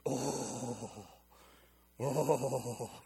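low, hushed, awestruck moans; dramatic awe. Retold or imitated. Friend recalling an overwhelming encounter, low and awed. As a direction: lightly voice a shaken friend. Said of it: "he was very serious and dramatic".

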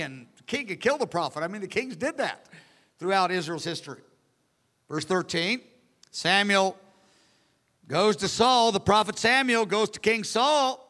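ordinary talk

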